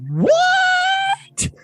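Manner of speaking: surprised. Retold or imitated. Listener's stunned reaction to twist.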